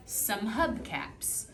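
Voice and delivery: Some hubcaps read slowly as one smooth scoop; scooped phrase. Shown as a demonstration. Teacher models phrase reading for a student, slow smooth scoop. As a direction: glide through Some hubcaps as one unit. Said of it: "the way that it should be read".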